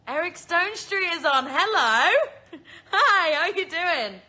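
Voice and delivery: loud, high burst of delighted hello; excited greeting. Real, not acted. Host spots a guest joining the live, excited greeting. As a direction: shout hello with a laugh bursting in.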